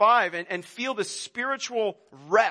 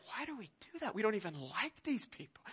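muttered mock grumble; 14 dB quieter than usual. Grumbling about family gatherings.

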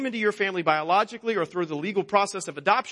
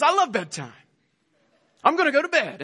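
imitating an excited small child, pitch jumping higher on the last words; eager little boy; 7 semitones higher, 4 dB louder, faster than usual. Father imitating his son announcing bedtime, lightly excited. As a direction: quote the son's happy bedtime announcement with childlike glee.

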